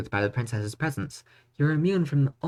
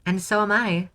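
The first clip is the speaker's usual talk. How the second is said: coy; 9 semitones higher, 4 dB louder than usual. Acted. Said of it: "Coyly, the princess smiles".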